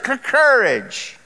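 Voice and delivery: stammer, then a drawn-out, high "courage"; stuttering Cowardly Lion. Retold or imitated. Preacher imitating the lion finally getting the word out, stammering. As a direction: stick on "cr-", then push the word out slowly. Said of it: "He couldn't say courage".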